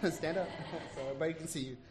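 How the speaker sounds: laughing through the words; giggling while talking. Real, not acted. Laughing while introducing new employee.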